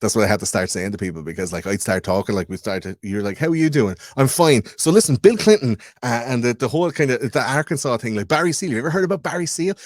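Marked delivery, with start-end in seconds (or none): 3.4-9.8 high, loud, tumbling enthusiasm; overeager mock rant. Himself cornering someone who only asked politely, overeager mock rant. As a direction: launch eagerly into the theory, hammed up.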